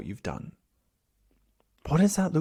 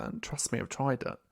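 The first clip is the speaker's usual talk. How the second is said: whispered aside; 4 semitones lower, 8 dB quieter than usual. Real, not acted.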